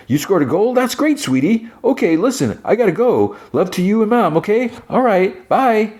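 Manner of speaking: put-on loud guy wrapping up a call with his kid; gushing dad on the phone. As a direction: fawn over a kid on FaceTime. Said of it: "talking loudly, like, on FaceTime".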